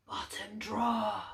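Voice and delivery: a cold hint from the shadows; quiet distant voice of the dark; the dark's voice. A narrator's reading. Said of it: "The voice of the dark was as creaky".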